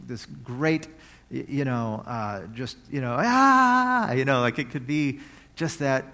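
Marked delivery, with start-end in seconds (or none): 3.2-4.1 a sudden high, loud cry of joy; joyful yell. Shown as a demonstration. Preacher showing God's raw cry of joy over his people. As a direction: burst out with one loud joyful Ah. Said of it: "joyful yell".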